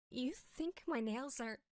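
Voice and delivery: low, very soft, offended; quiet menace. Acted. Insulted nail owner to the kid, low hushed threat. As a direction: hush it dangerously low.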